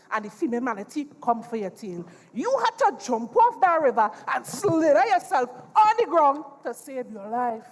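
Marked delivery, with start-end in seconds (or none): none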